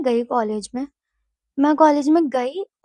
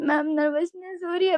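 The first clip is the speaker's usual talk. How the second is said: raised but restrained mock whining; lightly whimpered complaint; imitated tearful plea; 4 semitones higher than usual. Nervous student explaining to ma'am, subdued mock tearful plea. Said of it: "भैं-भैं करके रो रही हूँ".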